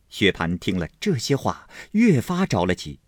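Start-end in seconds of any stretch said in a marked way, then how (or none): none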